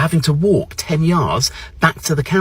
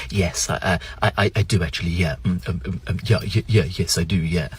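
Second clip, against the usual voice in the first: low, soft, bumbling British stammer; hushed, hesitant English politeness; Hugh Grant; 7 semitones lower, 7 dB quieter than usual. Acted. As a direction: fluster quietly through false starts and ums. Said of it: "I suddenly became Hugh Grant".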